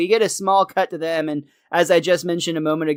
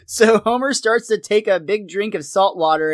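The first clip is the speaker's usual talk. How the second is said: ordinary delivery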